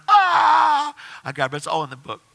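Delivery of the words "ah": loud, high, long "ahhh"; child's delighted scream. Retold or imitated. Kid running to returning father, delighted scream. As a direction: imitate a kid's joyful shriek.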